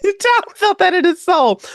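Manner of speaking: giggling as she says it again; amused laugh-talk on the repeat. Real, not acted. Repeating a joke about Tom.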